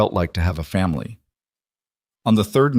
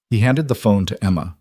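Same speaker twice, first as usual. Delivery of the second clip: plain reading voice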